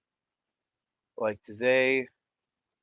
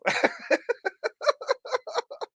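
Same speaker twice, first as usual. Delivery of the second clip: squealing high laugh. Real, not acted. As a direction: burst into laughter. Laughs after joke ad.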